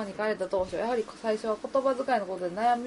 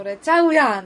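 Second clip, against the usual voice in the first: 「それちゃうやん」 loud, weight on the last part; Kansai stress at end; 3 semitones higher, 10 dB louder than usual. Shown as a demonstration. Host demoing Osaka phrasing to co-host: loud, end stressed. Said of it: "後半を張ったらいいね".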